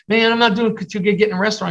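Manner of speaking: a touch of whiny friend; discouraged friend. Retold or imitated. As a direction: mildly imitate a moping buddy. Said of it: "my friend called me, goes".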